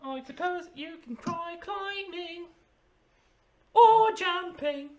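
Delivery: very high, mocking creature voice; teasing creature. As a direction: offer useless advice in a high squeak. Game master answers as Runcible.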